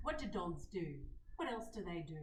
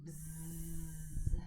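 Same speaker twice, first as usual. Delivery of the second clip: gentle bee buzz breath; bumblebee buzz; 5 semitones lower than usual. Shown as a demonstration. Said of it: "let's just do a few bumblebee breaths".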